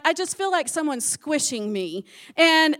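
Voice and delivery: ordinary talk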